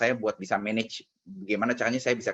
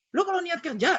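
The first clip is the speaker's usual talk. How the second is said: high-pitched telling-off; angry scolding. Retold or imitated. Uncle berating nephew about work attitude, angry. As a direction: lecture crossly, voice pitched right up. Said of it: "dia sebegitunya marah".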